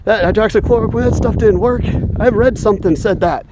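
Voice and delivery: high mocking voice of a gullible person; sneering impression of the brainwashed; mocking quote. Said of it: "brainwashed people saying".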